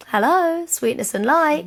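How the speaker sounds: high, slowing, sugary greeting; lightly hammed-up sweet horse. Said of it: "she's like".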